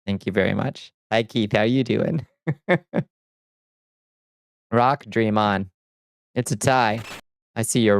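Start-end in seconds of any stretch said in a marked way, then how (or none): 2.5-3.0 short high giggle; a quick high-pitched chuckle; laugh. Real, not acted. Amused streamer reacting to chat, short laugh.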